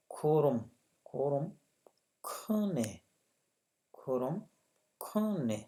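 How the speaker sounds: खोरम and खने spelled out syllable by syllable; careful syllable demo of खोरम; Korean word demo. Shown as a demonstration. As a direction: sound out खोरम then खने clearly. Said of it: "जैसे ये लिखा हुआ है".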